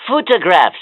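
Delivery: usual speaking voice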